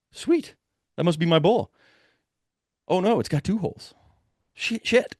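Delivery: high-pitched glee dropping into dismay; a touch of mock excitement turning to alarm; excited then dismayed. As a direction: go from thrilled to crushed. Finding the shot elk.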